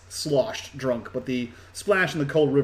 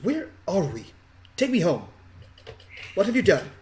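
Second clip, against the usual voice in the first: put-on imperious little girl, pitched up at times; ostentatious young girl; 5 semitones higher than usual. Girl pulled from the river to her rescuers, haughty and demanding. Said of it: "most ostentatious, uh, ten-year-old girl".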